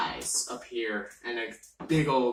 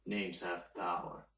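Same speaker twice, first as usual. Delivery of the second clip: ominous whisper; 7 semitones lower, 11 dB quieter than usual. Acted. Entity speaks low.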